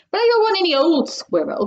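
high-pitched, mild shout; lightly acted bratty shout. A narrator's reading. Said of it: "Veruca shouted".

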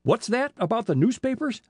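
high, startled demand. A narrator's reading. Police chief alarmed by talk of newspapers, demanding. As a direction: pitch it up as a sudden challenge. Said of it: "demanded the chief".

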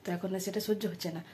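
soft, careful voice sparing a sore throat; speaking very softly. Real, not acted. Vlogger explaining her cold to viewers, speaking softly. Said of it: "তাই আমি খুব আস্তে আস্তে কথা বলছি".

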